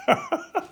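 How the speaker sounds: short laugh. Real, not acted. Laughs at own mockery.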